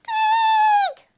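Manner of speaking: very high, piercing mock shriek; excited shriek. Storyteller shows children the stepsisters' excitement, high shriek. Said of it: "Can I hear your shriek?".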